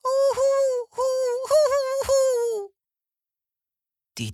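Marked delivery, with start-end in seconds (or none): 0.0-2.7 very high, loud hooting wail; terrible owl howling. A narrator's reading. Crying owl in the foggy forest, high loud hoots. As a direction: howl like a miserable little owl. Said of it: "ein schreckliches Heulen".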